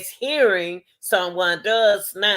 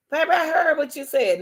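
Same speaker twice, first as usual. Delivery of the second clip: mock dismissive partner; 9 semitones higher than usual. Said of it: "When you hear somebody say".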